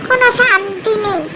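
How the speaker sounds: a fed-up fox craving meat; grumbling hungry fox. Acted. (জোরালো গলায় বিরক্ত ক্ষুধার্ত শিয়াল)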